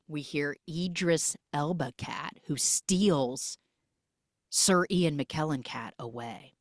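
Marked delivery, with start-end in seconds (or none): none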